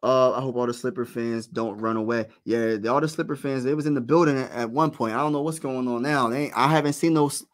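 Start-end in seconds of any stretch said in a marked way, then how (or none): none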